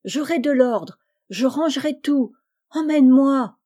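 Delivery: high plea building to a shout; pleading cry. A narrator's reading. Boy trying to soften his uncle, crying out. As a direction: beg urgently, loudest at the end. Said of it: "Il crie".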